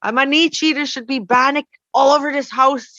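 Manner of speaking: high, frustrated self-scolding; half-laughing rant at herself; upset at myself. Retold or imitated. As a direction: scold yourself in mock exasperation. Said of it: "I got upset at myself".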